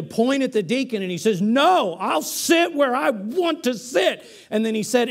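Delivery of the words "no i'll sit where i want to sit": mock irate shout, pitched much higher and slow; imitating an irate man shouting back; angry yell. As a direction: retell an angry man's outburst, high and insistent. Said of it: "he began to scream".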